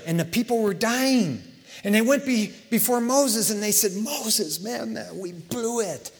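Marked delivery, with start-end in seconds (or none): 3.9-6.1 high, slow, softer admission of fault; mock sheepish pleading. Bitten Israelites come before Moses to confess, mock sheepish pleading. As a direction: own up in a drawn-out plea.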